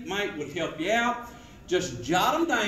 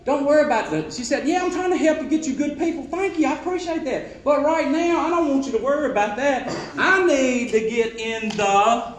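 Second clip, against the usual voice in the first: louder, higher upbeat reassurance; eager salesman; 4 semitones higher, 7 dB louder than usual. Retold or imitated. Salesman steering the customer back to the drawing, upbeat and loud. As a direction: brush off the worry and push the drawing.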